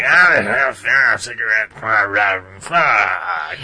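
lightly voiced slurring old drunk; drunk old man slurring. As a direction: mumble and slur like an old smoker. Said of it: "eighty-year-old, like, cigarette voice".

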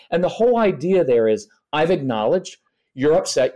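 ordinary talk, nothing marked

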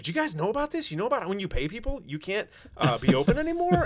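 high-pitched, disbelieving whine; mock incredulity. Noma tells everyone about paying people, mock incredulous. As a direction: play naive shock, voice leaping way up. Mocking Noma's shocked complaint.

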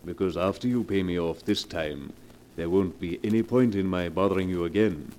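low, smooth, unhurried menace; oily blackmailer. Acted. Blackmailer coolly naming his price to Mrs Morgan, low silky menace. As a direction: purr the threat, savouring it.

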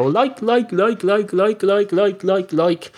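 high, droning parody of youth filler-speak; mock youngster. Mocking how young people speak, high imitation. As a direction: repeat it high and evenly like a stuck record. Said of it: "they go".

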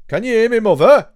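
loud, high call across the room. Real, not acted. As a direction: call out to someone far away. Calling out to absent mother.

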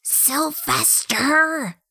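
strained, slow hissed name; hissing whisper. A narrator's reading. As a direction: hiss the name, drawn out and tense. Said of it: "she hissed, a strained whisper".

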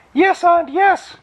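tiny orphan chorus. A narrator's reading. Orphans answer Ond together, very high chorus. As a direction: chirp it like a swarm of tiny beings. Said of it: "chorused the orphans".

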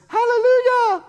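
very high, loud hallelujah holler; distant answering shout. Retold or imitated. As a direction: imitate hikers yelling back from below. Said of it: "from below us, you heard this".

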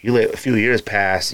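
ordinary delivery

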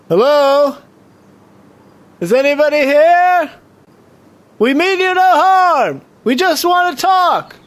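high, slow, carrying call. Acted. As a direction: call out gently to someone hidden. Calling out to hidden stranger.

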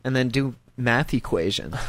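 normal talking voice

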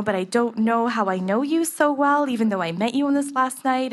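ordinary talk

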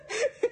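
loud high laugh; real high-pitched laughing fit; burst of high laughter. Real, not acted. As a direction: crack up loudly. Laughing at mock reply.

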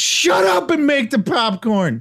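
high, slow mocking parent; annoyed parent. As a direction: snap at the kid. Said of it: "my parents would just".

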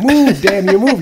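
loud, high mock yell at the ship; will the sinking ship to move with a shout; urging shout. A viewer urging the ship on, mock yelled loud and high.

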